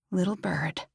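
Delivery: "Little bird," breathed as a voiceless whisper; whispered echo. Acted. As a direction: whisper "Little bird," back at him, mocking. She echoes his words back.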